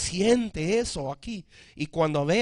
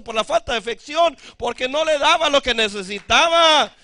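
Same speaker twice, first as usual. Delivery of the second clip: louder and louder, high preaching cry; full-force preaching; 7 semitones higher, 11 dB louder than usual. Acted. Pastor preaching to his church, building to a loud cry. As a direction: drive it home to the congregation, building.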